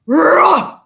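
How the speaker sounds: loud Viking war roar. Retold or imitated. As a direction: roar like a challenging beast. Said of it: "a Viking kind of".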